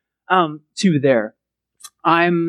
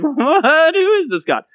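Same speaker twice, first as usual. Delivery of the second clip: mock incredulity; 9 semitones higher, slower than usual.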